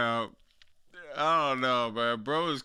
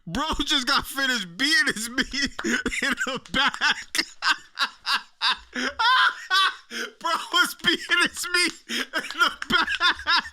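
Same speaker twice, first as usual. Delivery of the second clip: higher-pitched, real cracking up while talking; laughing hard; 4 semitones higher than usual. Real, not acted. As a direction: barely get the joke out through laughter. Cracking up while riffing on clip.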